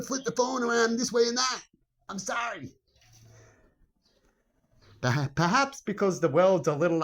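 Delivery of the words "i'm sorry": usual speaking voice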